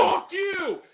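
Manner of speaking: high, loud yell of fuck you; mock outraged yell at the movie; shouted curse. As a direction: yell it at the screen. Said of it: "standing up and going".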